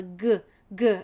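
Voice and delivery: hard "g" sound alone, twice; isolated "g", not the letter name; ending sound demo. Shown as a demonstration. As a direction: pop out each "g" as a separate letter sound. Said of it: "find the ending sound".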